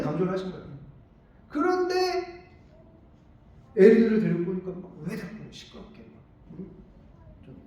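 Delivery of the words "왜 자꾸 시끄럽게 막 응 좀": hushed mock scolding. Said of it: "막 이렇게 한 거예요".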